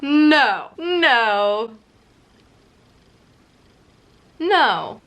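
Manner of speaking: loud protesting "No"; real dismayed loud no. Real, not acted. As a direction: refuse the twist out loud. Protesting a plot turn.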